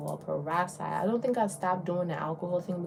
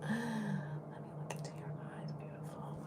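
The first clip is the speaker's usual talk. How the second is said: much softer, unvoiced and breathy-hushed; lady cupping her face, in an admiring whisper; imitating a whisper; 4 semitones higher, 10 dB quieter than usual. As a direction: whisper it like a stranger charmed by her eyes. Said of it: "was like".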